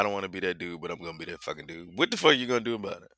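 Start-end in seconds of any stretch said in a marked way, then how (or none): 0.0-2.0 rushed under-breath aside; mumbled quick confession; quiet fast aside. Real, not acted.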